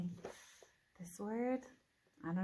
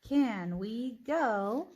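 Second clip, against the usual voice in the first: very slow, stretched, rising on go; slow questioning read-aloud; 3 semitones higher, 5 dB louder, much slower than usual. Shown as a demonstration. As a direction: drag each word, lift go. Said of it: "Read it all together".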